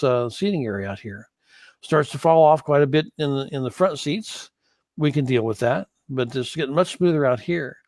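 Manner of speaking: ordinary talk, nothing marked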